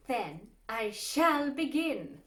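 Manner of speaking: usual speaking voice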